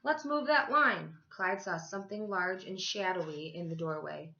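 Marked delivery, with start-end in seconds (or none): 0.0-1.2 put-on booming, slow call to the kids; higher and louder, herding the line along; lunch lady call. Lunch lady calls out to the line of children, big and slow.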